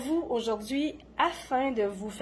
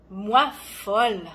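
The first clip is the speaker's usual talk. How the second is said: indignant disbelief; 5 dB louder, much slower than usual. Acted. Poet retorts to being called mad.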